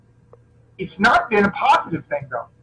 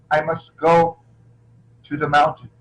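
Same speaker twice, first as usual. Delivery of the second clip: David Carradine; 4 semitones lower than usual. Acted. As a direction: stretch it out with solemn pauses between phrases. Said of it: "he, he would go".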